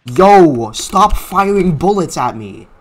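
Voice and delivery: loud startled complaint; alarmed shouting. Real, not acted. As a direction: yell at whoever is shooting you. Shot at in game.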